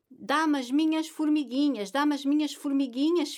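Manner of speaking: high, loud teasing plea for her cap; playful loud plea. A narrator's reading. Mother chasing her son for her cap, playful loud plea. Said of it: "dizia Ana Maria".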